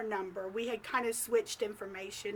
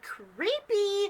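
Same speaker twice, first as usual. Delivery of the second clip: high, drawn-out recoil; mock alarm, pitched up; creeped-out reaction; 7 semitones higher than usual. Narrator reacts to lady finding her at the hotel, creeped out.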